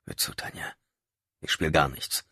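soft, whispered pushback; hushed retort. Acted.

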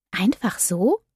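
stunned whisper. Acted. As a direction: breathe it out in disbelief. Stunned narrator asks.

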